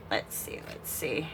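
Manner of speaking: breathless whisper while concentrating; whispered to herself. Real, not acted. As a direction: murmur it under your breath.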